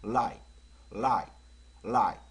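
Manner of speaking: like said short, tongue up for the L; crisp single like, repeated with pauses; L pronunciation drill. Shown as a demonstration. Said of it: "을 하면 혀가 위로 딱 올라가요".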